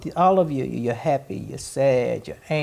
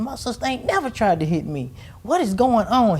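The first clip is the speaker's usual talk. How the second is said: mock upset brother; 9 semitones higher than usual. Brother upset his sister tried to hit him, high indignant mimicry. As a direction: play the hurt brother who can't believe it. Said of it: "He was upset".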